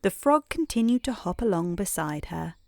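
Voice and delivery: usual speaking voice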